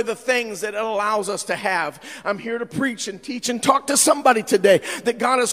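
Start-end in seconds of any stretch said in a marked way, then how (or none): 2.2-5.5 loud, ringing sermon delivery; full-force preaching. Acted. Pastor preaching loudly to the congregation. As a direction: preach it out to the whole church.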